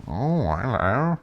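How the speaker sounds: slow, drawn-out posh hello; lazy upper-class hello, stretched slow; mock posh greeting. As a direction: mimic a toff greeting you. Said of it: "I just hate the posh voice".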